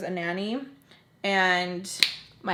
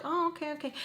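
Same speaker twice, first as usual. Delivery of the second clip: higher, much softer brush-off; mimicked distracted agreement; 6 semitones higher, 8 dB quieter, faster than usual. Customer looking at the phone while told the price, mimicked soft high brush-off. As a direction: lightly imitate someone half listening.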